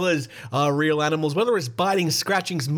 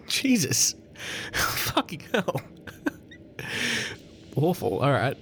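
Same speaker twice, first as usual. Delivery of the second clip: laughing; 3 semitones higher, 5 dB quieter than usual. Real, not acted. Shocked laughing at the story.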